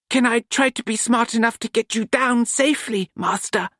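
polite with a sly edge; cheeky apprentice Nut. A narrator's reading.

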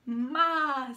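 loud goat call; goat bleat. A narrator's reading. Goat bleats loudly to the farm animals. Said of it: "said the goat".

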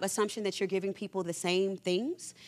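usual speaking voice

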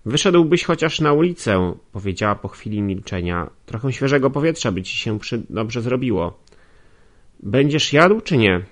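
a bossy servant prodding him; servant woman's voice. Acted. Nastazja voiced, high.

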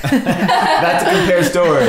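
laughing aside. Real, not acted. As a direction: laugh out the joke. Joking about her weight.

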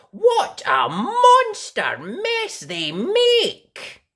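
plain reading voice

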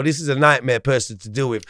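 ordinary talk, nothing marked

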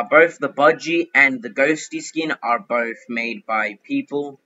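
ordinary talk, nothing marked

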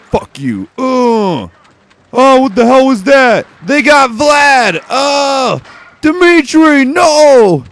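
over-the-top distressed put-on voice; mock anguished soldier. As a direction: grieve for Dimitri like a cartoon soldier. Voicing soldiers losing comrade.

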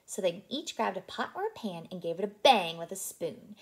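ordinary delivery, nothing marked

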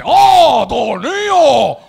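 "tornillo" drawn out loudly in mock Chinese accent; hammed-up Chinese-accented "tornillo" after a big "oh"; mock Chinese shopkeeper accent. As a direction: shout "tornillo" as one long Chinese-accented word. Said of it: "Y te dice".

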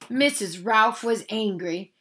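plain reading voice

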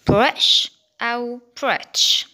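"بريتش" first with glottal stop, then with T; slow, loud "Bri'ish" or "Britsh"; two British pronunciations. Shown as a demonstration. Teacher contrasting both British options, glottal stop then T. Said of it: "إما بتنطقها تي يا إما".

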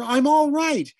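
high, loud yell; reassuring yell. A narrator's reading. Briggs yells to reassure the mother, high and loud. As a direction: yell it to calm her. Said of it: "Briggs yelled to reassure her".

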